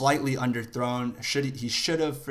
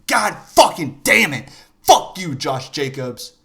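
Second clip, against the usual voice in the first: higher, loud burst of swearing; angry cursing; 3 semitones higher, 10 dB louder than usual. Real, not acted. Fan cursing the running back, loud angry outburst. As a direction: curse him out.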